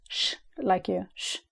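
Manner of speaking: soft "sh" like hushing someone; shushing sound demo. Shown as a demonstration. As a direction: hush the "sh" as to a child. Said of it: "which sounds like this".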